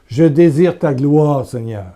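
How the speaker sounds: slow, deliberate prayer line; slow prayer demo. Shown as a demonstration. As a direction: pray it slowly for them to repeat. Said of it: "Répète".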